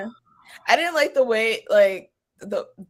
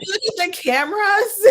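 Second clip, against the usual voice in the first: laughing; 13 semitones higher, 5 dB louder than usual. Retold or imitated. Retold security scene, laughing.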